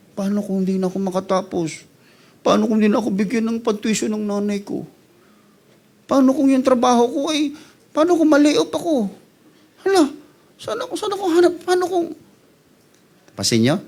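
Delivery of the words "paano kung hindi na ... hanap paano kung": rising higher into panicky questions; mock fearful worrying. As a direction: spiral into anxious what-ifs. (kunwaring takot at pag-aalala)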